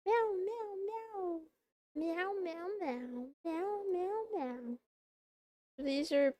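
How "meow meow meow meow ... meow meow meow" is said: cute kitten meows to chat; cat meows. Pretend. Streamer meowing again.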